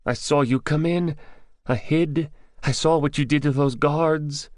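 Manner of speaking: high-pitched captive's voice; high, nervy character voice; nervous captive. Acted. Captive's figure in narrated story.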